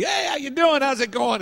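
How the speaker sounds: high, loud gushing hello, mimicked; imitated overfriendly greeter. Someone coming up to greet the preacher, exaggerated friendliness. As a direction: greet loudly and high, hammed-up.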